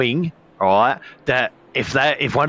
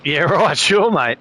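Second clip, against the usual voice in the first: laughing, higher sarcastic "yeah right"; scoffing, higher "sure, mate"; sarcastic brush-off; 5 semitones higher than usual. Retold or imitated. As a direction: brush him off with a laugh, mockingly. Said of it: "I'm like".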